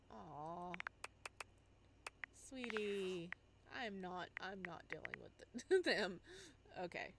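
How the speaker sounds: normal talking voice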